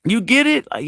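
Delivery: ordinary talk, nothing marked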